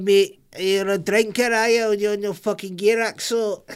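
high-pitched, hammed-up Scottish accent; Scottish drug user. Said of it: "Raxo's a guy that deals with gear".

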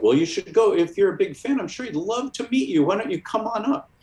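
high, eager, sing-song doorman voice; a pushy doorman cheerfully inviting you upstairs; overeager doorman. Retold or imitated. Doorman urging the young fan to visit Ditko, high and eager. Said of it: "this overeager doorman".

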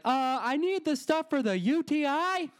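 high mock yell across the store. He yells his request across the pharmacy, high. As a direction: call it out high, swallowing pride. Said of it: "yelled at".

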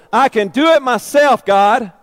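put-on loud, high, whiny child insisting; defiant toddler. Believer as a two-year-old telling God, whiny defiance. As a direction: stamp your foot like a toddler. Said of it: "like a little two-year-old".

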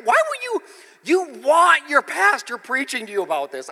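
very high, loud outburst; protesting scared churches; emphatic mock challenge; incredulous exclamation. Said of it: "I'm like".

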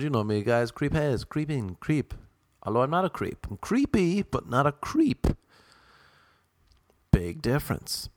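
ordinary talk

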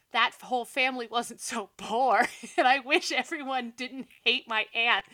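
can't stop laughing mid-sentence; laughing through the words. Real, not acted. Retelling sad letter, laughing.

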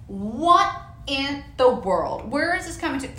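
ordinary talk, nothing marked

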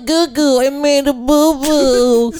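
put-on high slow baby babble. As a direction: coo it out like a baby. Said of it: "could you translate".

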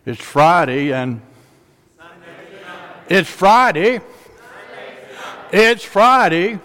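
building fervent proclamation; rising call. Real, not acted. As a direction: call it out to the congregation. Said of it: "let's just keep it real simple".

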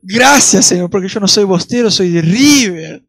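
loud, booming joke thanksgiving; shouted mock prayer, stretching the last words; mock-triumphant prayer. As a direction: belt out a teasing thank-you to God. Joking loud mock prayer about football.

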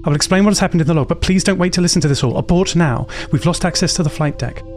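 fast, desperate and pressing; frantic urgent plea. Acted. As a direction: beg them to abort now.

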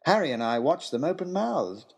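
plain reading voice

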